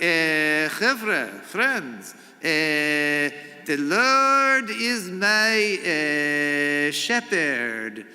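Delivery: Sermon on the Mount in a hammed-up Israeli accent with "eh" fillers; Israeli-accented preacher with a drawn-out, half-sung line. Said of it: "with an Israeli accent".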